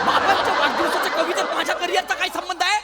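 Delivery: indignant outburst. Acted. Man objecting to jabs at his career, indignant. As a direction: burst in loudly, outraged at the poem. Objects to being mocked in poem.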